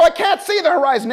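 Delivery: loud, high panicked yell; student pilot shouting back at instructor, panicked. Retold or imitated.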